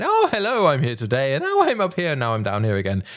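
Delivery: exaggerated sing-song up and down; wild pitch swings. Shown as a demonstration. Showing the audience how not to vary pitch, over-the-top sing-song. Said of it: "You don't wanna be going".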